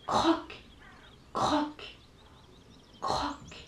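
soft whispered croaks; croaking. A narrator's reading. Mysterious noise from snail's house, soft croaks. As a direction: croak it quietly.

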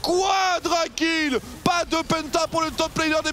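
slow drawn-out announcer shout; fighting game announcer. Real, not acted. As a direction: stretch out the name like an arena announcer. Annonce du triple et quadra kill. (annonceur de jeu de combat)

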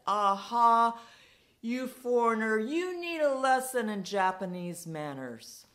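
put-on shouting drunk picking a fight; drunken shout. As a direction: bellow it at the foreigner to pick a fight.

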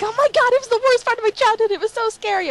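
high, loud mock melodrama; overreacting person. Mocking people who overreact to Disney scenes, hammed-up and high. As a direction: gush it like a hammed-up drama queen. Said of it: "people just overreacted".

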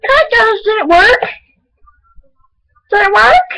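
squeaky voice, way up high after the drink; helium-high voice. Real, not acted. Kid testing a voice-changing drink, squeaky high. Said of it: "see if it changes my voice".

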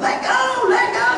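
high-pitched hollered plea; mock panicked yell. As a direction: re-enact a dad yelling under clinging kids. Father pleading under climbing children.

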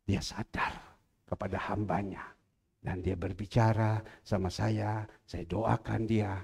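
ordinary talk

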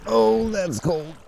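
high, slow startled gasp at the cold; cold shock. Real, not acted. Reaching into the frigid creek for a bottle, sharp gasp. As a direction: yelp at the icy water. Hand into icy creek water.

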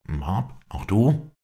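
astonished question, leaping up at the end. A narrator's reading. Prisoner recognizes the servant, astonished. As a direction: exclaim in surprise, voice rising high. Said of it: "rief Halford erstaunt".

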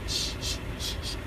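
soft, voiceless repeated shh; shushing. Pretend. Man quieting his crying baby, gentle shushing.

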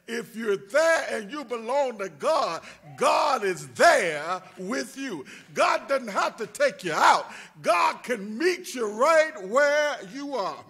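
loud, slow preaching climax; shouted preaching. Acted. Pastor assuring the congregation God is there, shouted preaching. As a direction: cry it out over the pews.

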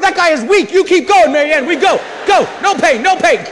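high, full-volume re-enacted rallying yell; hammed-up relentless motivational shout; shouting coach. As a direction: scream your wife up the mountain. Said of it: "I'm shouting up the mountain".

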